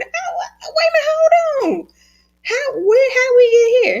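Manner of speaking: put-on high, tearful little-girl voice; crying little girl. Mother imitating her crying daughter's words. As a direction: whimper it like a small upset child.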